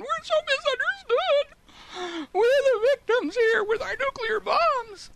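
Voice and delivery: high, slow, far-off crybaby whimper; mock whining. Mocking critics as whiny victims, slow high whimper. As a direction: whine it like a wounded victim. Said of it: "whining and screaming and crying and whimpering".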